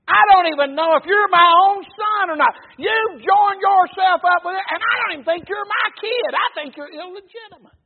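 loud, high-pitched enraged rant, voiced as Saul; furious king shouting at his son, retold; angry paraphrase. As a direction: yell it like a father disowning his son. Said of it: "That's what he's saying".

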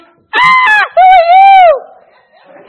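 very high, loud, slow shriek with a laugh; startled shriek. Acted. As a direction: shriek in shock at a stranger. Wife startled in story.

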